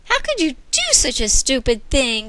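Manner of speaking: sharp, high demand. Acted. Boss scolding cashier, demanding and sharp. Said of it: "demanded Cindy".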